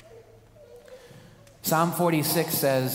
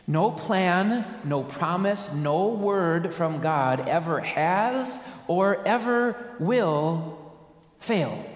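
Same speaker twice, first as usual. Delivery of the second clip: emphatic declaration; 2 semitones higher, much slower than usual. Acted. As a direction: build to fail.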